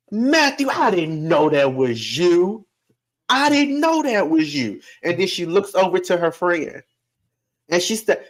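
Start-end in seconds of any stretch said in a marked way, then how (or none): 0.1-2.7 a surprised great-grandma draws out the greeting; great-grandma's voice. Retold or imitated. 3.3-4.8 "I didn't know that was you" sung out much higher; great-grandma's voice. Retold or imitated. Great-grandma repeats the greeting.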